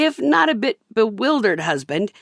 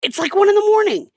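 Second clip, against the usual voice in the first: high, louder whiny husband voice; 13 semitones higher, 4 dB louder, faster than usual. Retold or imitated. Husband protests to wife about the late hour, high and whiny. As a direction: whine like a put-upon man.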